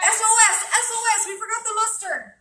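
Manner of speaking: high, loud playful SOS call; mock alarm. Forgot an ingredient, mock alarm.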